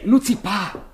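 loud, high urgent rebuke; a sharp, high telling-off; urgent hushing. Acted. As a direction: stop him shouting, urgently. Hushing his shouting.

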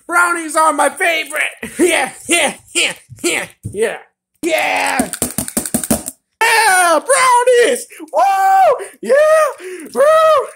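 high, loud whoops and yells; jubilant cheering. Acted. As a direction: whoop it up, building to screams. Celebrates favorite treat with cheers.

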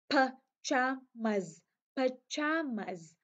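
"pajamas" spoken very slowly, syllable by syllable; slow demo of pajamas. Shown as a demonstration. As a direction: drag out each part of "pajamas". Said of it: "cái chữ này nó đọc như sau". (đọc "pajamas" thật chậm từng âm)

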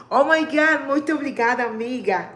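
excited thanks. Real, not acted. Thanking a friend on live.

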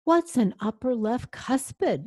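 a touch of storybook worry; trembling. A narrator's reading. Vampire puzzled by the dentist, mildly trembling. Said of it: "asked, trembling".

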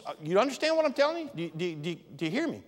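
ordinary talk

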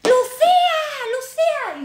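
high, loud holler; mock shout. Stranger yelling after woman outside the club, high shout. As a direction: yell the name as if across a street. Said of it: "y de repente gritan".

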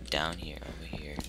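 whispered directions to self; whispered sneaking. Real, not acted. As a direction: hush it while sneaking. Sneaking past the monster.

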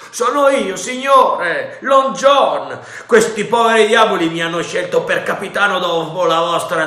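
high, loud pirate voice; Silver, sly pirate. A narrator's reading. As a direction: sell yourself as captain. Silver answers the captain.

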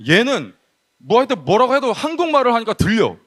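normal talking voice

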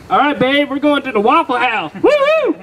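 treat your date like you just won big; hyped-up celebration. Retold or imitated. Broke guy cashing a twenty dollar check, excited whoop. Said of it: "and I'm like".

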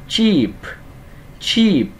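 "cheap" high, with long falling ee and puffed p; bật âm P cuối. Shown as a demonstration. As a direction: draw out "cheap" and let the p pop. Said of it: "Có chữ P nè". ("cheap" giọng cao, i dài, bật p cuối)

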